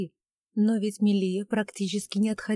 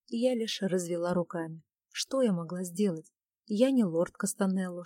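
ordinary delivery, nothing marked

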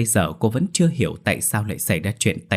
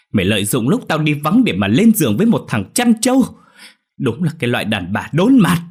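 louder, higher, betrayed fury; enraged roar; 5 semitones higher, 6 dB louder than usual. Acted. Husband Long roaring at his accused wife, enraged. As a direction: roar it at the wife who cheated. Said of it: "Long gào lên đầy phẫn nộ".

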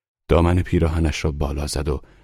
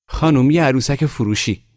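put-on high, loud boy's sales call; 10 semitones higher, 7 dB louder than usual. A narrator's reading. Boy stops a woman in the snow, calling his offer. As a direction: call out to a passerby.

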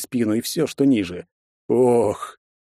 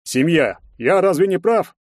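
high, loud taunting appeal; jeering, pitched well up; mocking tone; 8 semitones higher, 4 dB louder than usual. A narrator's reading. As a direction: taunt the whole room with the question. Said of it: "Насмешливый тон резко сменился".

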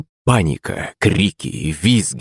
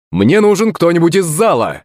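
stage magician; 10 semitones higher than usual. Acted. Magician calls out to the audience.

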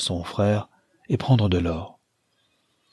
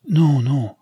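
higher, louder quick denial; hasty reassurance; 6 semitones higher, 5 dB louder than usual. A narrator's reading. Young man answering the worried host about his brother, hastily reassuring. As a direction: jump in to deny it, voice lifted. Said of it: "se hâta de répondre Michel".

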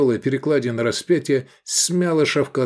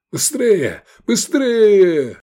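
high cries building louder; urgent yelling; 11 semitones higher, 6 dB louder, much slower than usual. A narrator's reading. Fleeing riders race for the gate, urgent shouts. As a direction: urge them on, the second cry longer and louder.

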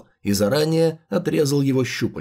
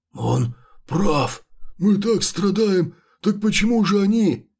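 high, slow wailing monster; wailing chimera voice; 5 semitones higher, slower than usual. Acted. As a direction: wail like a tormented creature.

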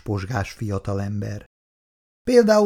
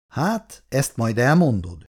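plain reading voice

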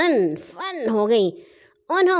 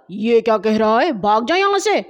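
sharp angry telling-off, louder; scolding; 4 dB louder, faster than usual. A narrator's reading. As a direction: shoo the fool away angrily. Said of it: "ڈانٹ کر بولا". (غصے سے ڈانٹتے ہوئے)

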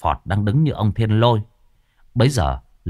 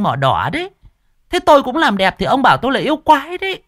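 much higher hands-on-hips tirade; sassy indignant wife; 13 semitones higher than usual. A narrator's reading. Wife with hands on hips scolding her husband, much higher. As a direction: berate him about her beauty treatments. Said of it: "nhướng mày, chống nạnh".

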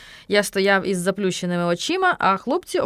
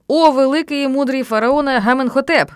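loud, raised ceremonial address; solemn invocation; 5 semitones higher, 7 dB louder than usual. A narrator's reading. Boy raising hands to the sun before pharaoh, grandiose. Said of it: "звів руки до сонця Стьопа".

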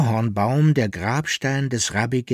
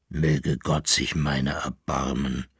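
low moaning plea; 8 semitones lower, 3 dB quieter than usual. Acted. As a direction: groan it out in dread. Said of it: "stöhnte Koppelbär".

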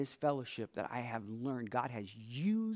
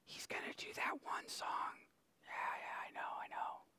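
whispered, quick mocking kid grumble; 13 dB quieter, much faster than usual. Pastor mimics kids at school groaning, whispered. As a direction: hiss it like bored schoolkids. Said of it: "They're like".

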